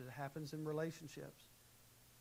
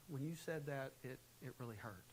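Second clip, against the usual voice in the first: calm; 5 dB quieter than usual. Shown as a demonstration. Spouse calmly telling partner how it hurt. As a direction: say it calmly and quietly. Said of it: "calmly respond".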